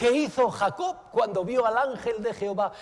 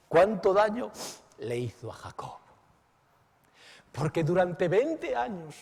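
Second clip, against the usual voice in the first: ordinary delivery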